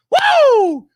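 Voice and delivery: a high, loud, held woo; high loud whoop. Real, not acted. Streamer cheering his live audience with a loud high whoop. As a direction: let out a big hype whoop. Hype intro shout.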